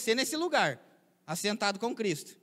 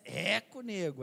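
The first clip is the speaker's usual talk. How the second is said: drawn-out Roseira-style "eco, nego"; Roseira intonation; slower than usual. Shown as a demonstration. Preacher showing variations of Roseira speech, local Roseira intonation. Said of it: "dependendo da entonação".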